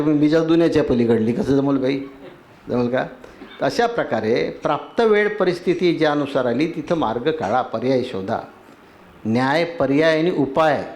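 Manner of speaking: ordinary talk, nothing marked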